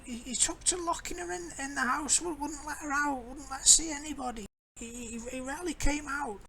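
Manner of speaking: put-on high quavering old woman, stammering; a fretful old crone gossiping; old lady voice. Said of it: "Old lady voice".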